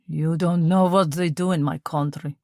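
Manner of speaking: put-on grim, heavy Russian accent; officer answering the priest darkly. A narrator's reading. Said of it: "he said darkly".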